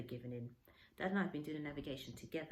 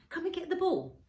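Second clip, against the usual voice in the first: coaxing call, much higher and louder; raised coaxing call to a dog; 12 semitones higher, 9 dB louder than usual. Retold or imitated. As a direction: call her in from the bow. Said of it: "I said".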